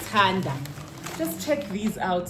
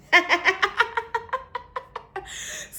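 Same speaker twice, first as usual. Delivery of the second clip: laughing; 12 semitones higher than usual. Real, not acted. Laughs at own impression.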